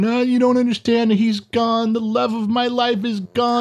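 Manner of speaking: high, wailing imitation of a panicked daughter; mock crying daughter. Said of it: "she calls you the same way, crying, going".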